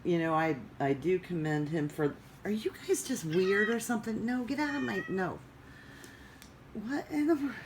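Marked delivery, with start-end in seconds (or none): 2.4-7.5 high, exasperated telling-off of the cats; cat owner losing patience; scolding cats. Real, not acted. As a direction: pitch shooting up in disbelief at the pets.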